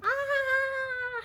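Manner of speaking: very high yelp, different timbre; dog's yelp. Retold or imitated. Teacher voicing the shocked puppy, a high yelp. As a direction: squeal like the startled puppy. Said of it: "the dog's going".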